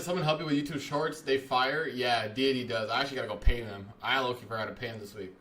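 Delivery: normal talking voice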